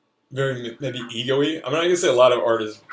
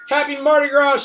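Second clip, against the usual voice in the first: high, loud festive yell; yelled greeting; 7 dB louder than usual. Acted. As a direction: shout it out while dancing off. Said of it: "she yells and dances away".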